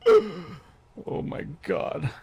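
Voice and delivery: slow, high, stunned; dread. Retold or imitated. Boy seeing his grandma in church, slow stunned dread. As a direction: freeze as grandma spots you. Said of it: "and I'm just like".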